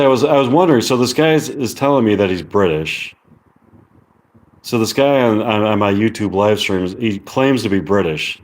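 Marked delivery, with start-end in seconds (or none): none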